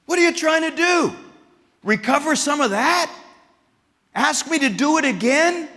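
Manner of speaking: high, teasing jabs; sarcastic God. Acted. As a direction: poke fun at the prophet. God voiced mocking Elijah.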